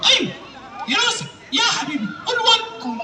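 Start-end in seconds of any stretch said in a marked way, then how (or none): none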